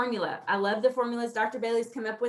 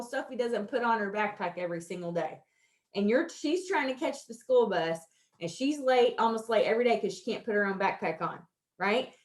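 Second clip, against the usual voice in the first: ordinary delivery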